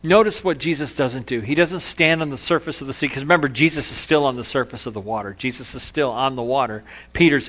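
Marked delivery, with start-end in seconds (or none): none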